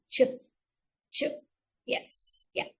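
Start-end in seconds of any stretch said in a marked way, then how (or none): 0.1-1.2 Ch said as a short bouncing burst of sound; phonics sound demo. Shown as a demonstration. Teacher modelling the sound for the children. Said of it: "this is the voice sound".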